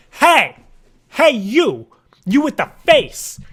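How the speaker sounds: loud, high, startling call-out; vocal shock. Pretend. Caller trying to make them jump in their chair, shouting. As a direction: jolt the listener with each shouted burst. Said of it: "a vocal shock when they answer the phone".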